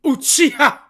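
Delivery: Uchiha said high and loud with dramatic flair; extra Japanese flair. Retold or imitated. Imitating Sasuke's dub actor, Uchiha said high and loud. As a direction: hit Uchiha like an anime voice actor. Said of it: "he puts the extra shit on".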